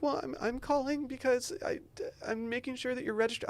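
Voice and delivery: nervous caller. Retold or imitated. Imitating a woman calling about voter registration, timid and high.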